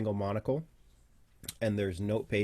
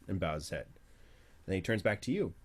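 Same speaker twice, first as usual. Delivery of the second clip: normal talking voice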